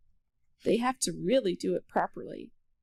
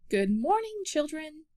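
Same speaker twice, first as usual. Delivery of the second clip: higher, cheery classroom hello; bright teacher greeting; 6 semitones higher than usual. A narrator's reading.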